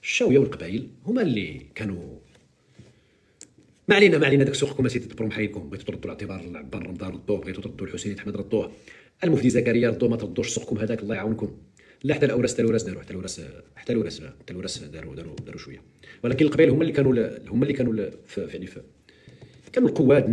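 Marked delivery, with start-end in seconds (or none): 3.9-4.4 louder and higher, brushing the topic aside; loud dismissal. Real, not acted. Commentator cutting off his own tangent, loud and dismissive. Cuts himself off abruptly. 14.2-15.8 barely voiced grudging concession; whispered aside. Real, not acted. Commentator conceding a point to viewers, whispered.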